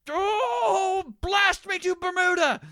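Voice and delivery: high, loud squawked Merlin cry; Merlin's outburst. Retold or imitated. To the co-host, imitating Merlin's angry exit shout. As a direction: blast it out like the furious old wizard. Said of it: "ends with Merlin going".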